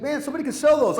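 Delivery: quoting the friend's high, eager realization; excited. Retold or imitated. Luke quoted, high and loud.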